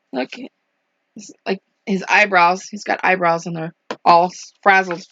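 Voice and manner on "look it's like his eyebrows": plain reading voice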